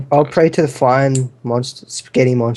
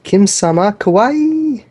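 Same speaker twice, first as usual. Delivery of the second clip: excited anime fan; 7 semitones higher, much slower than usual. Pretend. Teammates tease player Kim.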